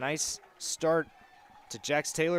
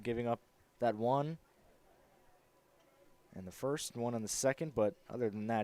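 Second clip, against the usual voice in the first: usual speaking voice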